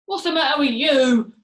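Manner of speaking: loud, irritated husband's question; loud demand. A narrator's reading. Mister Twit to his screaming wife, loud demand. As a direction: snap it out loudly at her.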